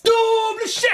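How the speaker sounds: high-pitched comic shout; stern outburst. Acted. Teacher punishing informers, stern outburst.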